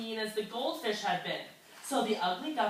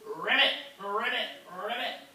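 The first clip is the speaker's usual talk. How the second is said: frog ribbit. Acted. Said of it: "They sang songs".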